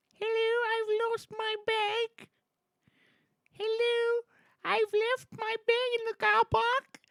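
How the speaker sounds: very high, slow, drawn-out put-on voice; Jamie's double voice. Streamer trying out a voice for the play, high and drawn-out. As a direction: stretch the words out in a high falsetto. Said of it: "Jamie Double voice".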